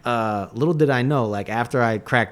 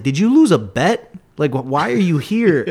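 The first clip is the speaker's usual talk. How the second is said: higher, louder mock disbelief; teasing jab; 5 semitones higher, 6 dB louder than usual. To the girl in the front row, loud mock disbelief. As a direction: needle her in front of everyone. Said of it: "I was like".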